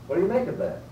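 high, pointed question to the class. Shown as a demonstration. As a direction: throw the question out to the room. Teacher poses question to class.

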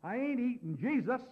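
mock-indignant public outburst; loud voice. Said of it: "he said in a loud voice".

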